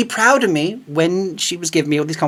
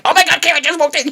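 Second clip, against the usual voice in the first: high, giddy fan squeal; excited squeal; 13 semitones higher, faster than usual. Retold or imitated. Telling friends how his group chat reacted, high excited squeal.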